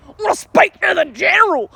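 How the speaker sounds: shouted tough guy demand. Retold or imitated. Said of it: "he's like".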